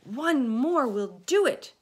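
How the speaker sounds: loud, high swooping call; eager full-voiced cry; shouting. A narrator's reading. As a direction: shout encouragement. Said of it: "shouted the old green grasshopper".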